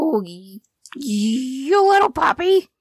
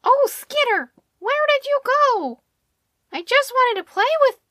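disappointed. A narrator's reading. Squirrel vanished, dog disappointed.

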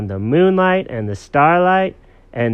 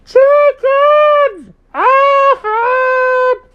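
high, long-drawn shouted names; loud, high sing-out call; 10 dB louder, much slower than usual. Acted. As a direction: holler for the pets from afar. Girls call for the pets.

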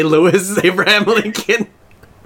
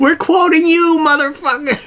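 mock yelling blame; 10 semitones higher than usual. Friends yelling at a singer that the song is his fault. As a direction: shout the blame back at him.

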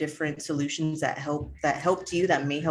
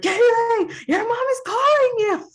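much higher, louder mock yell for Kaylee; mocking mom's call; 8 dB louder than usual. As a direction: shout it up high like a nagging mom. [name] mocks the mom's calling.

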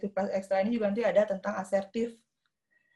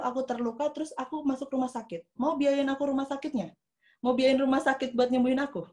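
put-on higher-pitched earnest child confronting others; assertive young boy; 5 semitones higher than usual. Six-year-old nephew confronting his bullies, firm and earnest. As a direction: challenge them with pointed questions, pitched up. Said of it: "dia bilang gini".

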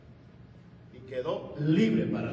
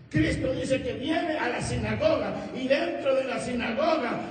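raised, emphatic sermon delivery; preaching; 6 semitones higher, 5 dB louder than usual. Acted. Pastor telling the congregation of the synagogue, fervent preaching.

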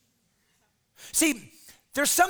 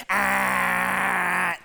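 put-on teen grunt; 3 semitones lower than usual.

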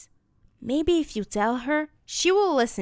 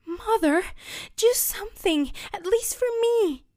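high, loud begging; begging girl; 11 semitones higher, 5 dB louder than usual. Acted. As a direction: plead desperately for help.

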